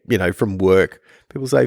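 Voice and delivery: normal talking voice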